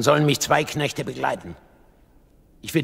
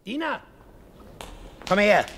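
high, sharp call for a servant; 8 semitones higher than usual. Acted. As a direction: summon someone from across the room. King calls for his servant.

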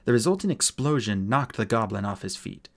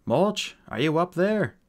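higher, drawn-out call upward; raised voice, searching for an answer; 6 semitones higher, 4 dB louder, slower than usual. Acted. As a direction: slowly call up to someone out of sight. Artemis calls up the column.

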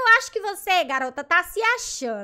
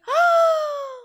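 delighted gasp; 12 semitones higher, 7 dB louder than usual. Real, not acted. Sees finished avatar.

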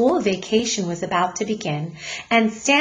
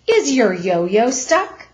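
teasing policeman; 2 semitones higher, 4 dB louder, slower than usual. Acted. Policeman mocks the mother.

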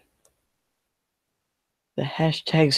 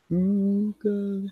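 ordinary talk

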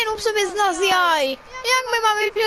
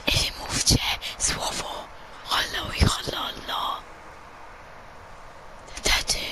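mysterious whispered voice; soft, voiceless whisper; Sterling; 7 dB quieter than usual. Pretend. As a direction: whisper like a hidden presence.

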